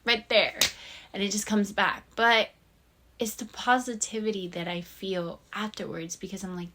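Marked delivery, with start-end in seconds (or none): none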